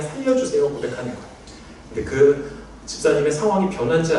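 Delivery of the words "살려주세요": a raised, simple plea for rescue. Shown as a demonstration. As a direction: say it plainly and high, just asking to be saved. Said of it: "하나님 앞에 그냥".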